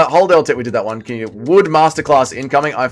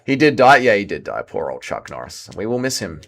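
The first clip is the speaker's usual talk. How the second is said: ordinary talk, nothing marked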